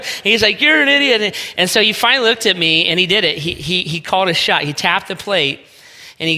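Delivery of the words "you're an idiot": high, loud mock-exasperated jab; exasperated retort. Friend snaps back at the teasing pitcher, mock exasperation.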